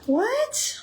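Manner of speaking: high startled "what"; shocked disbelief; surprised. Real, not acted. Surprised at peeled garlic.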